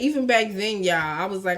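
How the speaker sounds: ordinary talk, nothing marked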